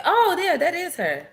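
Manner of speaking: high-pitched mock moment of recognition; acted recognition. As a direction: act out suddenly spotting her, pitched high. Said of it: "I could say".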